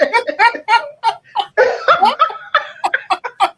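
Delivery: long howling laugh, very high and loud; loud mocking laugh. Real, not acted. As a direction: keep cackling in scorn. Keeps laughing at him.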